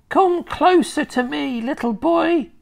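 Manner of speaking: put-on high, coaxing old hag; beckoning witch. A narrator's reading. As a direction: lure the boy in, witch voice.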